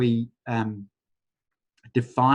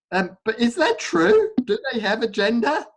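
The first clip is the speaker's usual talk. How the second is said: much higher, incredulous; a playful, high-pitched double take; amused disbelief; 10 semitones higher than usual. Real, not acted. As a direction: question it as if caught off guard. Questions her own crazy assumption.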